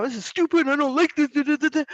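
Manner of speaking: put-on high, loud whiny child complaining; imitating a frustrated child. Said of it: "children will come to us quite frustrated".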